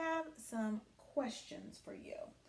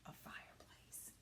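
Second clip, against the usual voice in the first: soft breathy whisper of the answer; 17 dB quieter than usual. Real, not acted. Teacher whispering the answer to the class. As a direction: whisper it like a secret.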